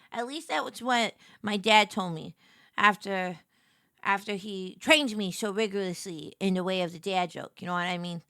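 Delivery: ordinary delivery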